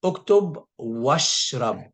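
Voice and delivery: Uktub washrab said very slowly and clearly; model phrase. Shown as a demonstration. Teacher modelling how to tell someone to write and drink. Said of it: "I would say".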